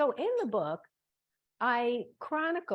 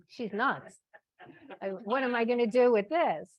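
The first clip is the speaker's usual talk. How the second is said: mock alarm; 3 semitones higher, 5 dB louder than usual. Retold alarmed thought about her.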